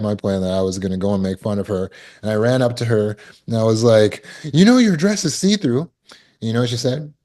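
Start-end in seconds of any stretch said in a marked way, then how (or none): 4.5-5.9 put-on high, loud childish taunt; mocking taunt. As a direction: tease her like a schoolyard bully. Kid taunting girl about dress.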